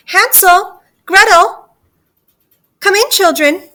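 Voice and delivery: high, loud singsong summons; witch's luring call, pitched high. Acted. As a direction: call children in from afar. Witch calls the children in.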